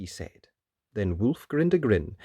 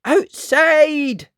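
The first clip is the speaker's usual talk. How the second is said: high, loud alarm; full-voiced warning cry; yelling; 11 dB louder than usual. Missus Jones warning everyone of the giant, yelling. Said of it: "Missus Jones yelled".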